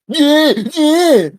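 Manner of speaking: high, loud sing-song yeah yeah; high singsong imitation of Zach's yeah. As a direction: copy a streamer's catchphrase. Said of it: "the thing that Zach does".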